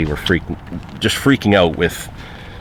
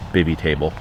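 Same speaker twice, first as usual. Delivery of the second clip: normal talking voice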